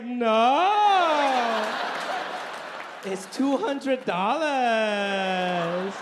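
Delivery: loud, very slow triumphant mom voice; mom's proud reveal. Retold or imitated. Son imitating mom revealing the bargain price, loud and slow. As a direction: savor the cheap price. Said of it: "And she was like".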